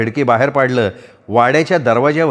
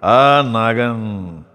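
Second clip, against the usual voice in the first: put-on very slow, drawn-out drunk mumbling; drunken babble; much slower than usual. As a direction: drag each word out, drunk and dreamy. Drunk charmer answers.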